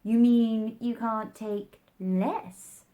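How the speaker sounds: drawn-out teasing Hatter. A narrator's reading.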